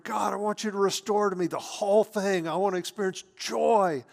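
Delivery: fervent praying David. Retold or imitated. David prays to God for restoration and joy, fervent. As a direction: plead boldly with God, voice lifted and forceful. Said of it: "here's David, he's praying".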